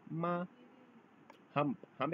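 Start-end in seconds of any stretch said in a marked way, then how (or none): 0.1-0.5 माँ held flat and level; level माँ for mother; Chinese first tone mā. Shown as a demonstration. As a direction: say माँ on a steady pitch. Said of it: "mother को Chinese language में बोलते हैं".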